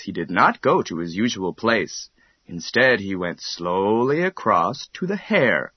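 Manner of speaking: ordinary delivery, nothing marked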